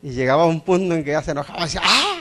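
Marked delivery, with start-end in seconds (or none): 1.8-2.1 high mock baby yell; imitated baby scream. Father mimics his baby son denied the bottle, mock scream. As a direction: cry out like a frustrated hungry baby.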